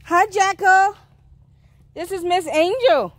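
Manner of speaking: high-pitched, friendly introduction; sweet-talk greeting. Real, not acted. Woman introduces Miss Angel to Jacko, high-pitched sweet talk.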